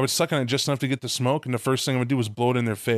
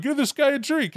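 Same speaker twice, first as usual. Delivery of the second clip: mocking partygoer. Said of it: "they would walk off like".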